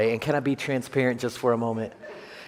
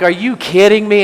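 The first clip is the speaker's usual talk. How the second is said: much louder and higher mock disbelief, with a laugh; incredulous; 8 semitones higher, 12 dB louder than usual. Pastor retells his reaction to the gym guy's advice, loud mock disbelief. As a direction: act out the outrage with a laugh.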